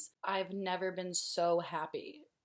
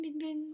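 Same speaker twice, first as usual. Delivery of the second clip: high, even chime-like dings; door chime imitation; 10 semitones higher than usual. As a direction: imitate an electronic door alert, pitched way up. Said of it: "you hear a ding".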